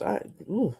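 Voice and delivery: a yawn breaking the words. Real, not acted. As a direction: sigh out mid-yawn. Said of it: "I start yawning".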